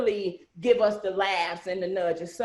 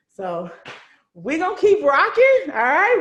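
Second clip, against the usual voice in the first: upbeat; 6 semitones higher, 7 dB louder than usual. Real, not acted. Hyping the team at sign-off.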